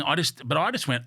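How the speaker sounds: ordinary talk, nothing marked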